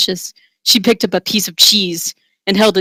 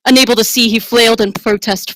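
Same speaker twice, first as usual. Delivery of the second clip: ordinary delivery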